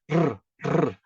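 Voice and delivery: "Rr, rr" as two rolled tongue-tip buzzes; trilled R. Shown as a demonstration. Teacher practising with learners, two trilled rr sounds. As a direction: practise rolling each "rr" on the palate ridge. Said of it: "Você tem que praticar".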